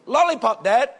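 put-on high, insistent little boy; imitation of a kid demanding a treat; nagging young son. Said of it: "It keeps going on and on".

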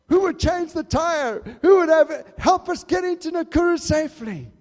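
loud, high preacher's cry; rising sermon shout; preaching at full force. Acted. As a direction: proclaim it to the congregation.

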